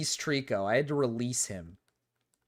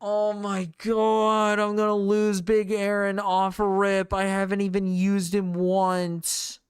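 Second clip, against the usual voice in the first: real high, loud despair; distressed outcry; 6 semitones higher, 6 dB louder than usual. Real, not acted. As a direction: cry out in dismay over a game loss. About to lose Big Aaron.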